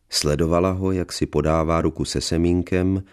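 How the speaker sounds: usual speaking voice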